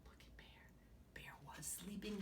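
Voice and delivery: put-on hushed whispered aside to the kids; storyteller whisper. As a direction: whisper as if not to wake anyone.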